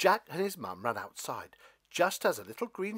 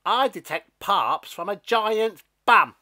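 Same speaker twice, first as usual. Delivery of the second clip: loud jeer; 9 semitones higher, 14 dB louder than usual. Acted. Stalk roars at giant.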